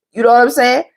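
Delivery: usual speaking voice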